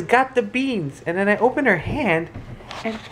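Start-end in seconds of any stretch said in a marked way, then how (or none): 0.0-1.0 imitating toddler. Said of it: "then she says".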